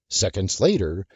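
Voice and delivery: plain reading voice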